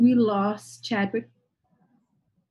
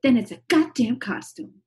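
imitating a commentator, pitched up and a bit louder; mock lecturing voice; 7 semitones higher, 3 dB louder than usual. Quoting Yaba's lecture to Adele, mock scolding. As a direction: lay down the rule like a scolding pundit. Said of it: "she's like".

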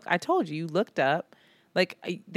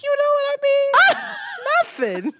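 laughing drunk imitation; 5 dB louder, slower than usual. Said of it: "And you were like".

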